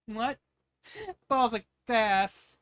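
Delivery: can't believe what she hears; incredulous. Acted. Pearl disbelieving the claim.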